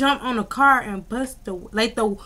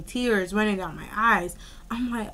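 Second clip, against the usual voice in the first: ordinary delivery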